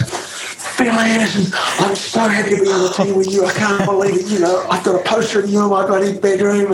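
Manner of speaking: put-on high, gushing young American fan voice; young Lance Armstrong. Young Lance greeting his new teammate, gushing and starstruck. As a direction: play the starstruck young rider meeting his hero. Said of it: "and he goes".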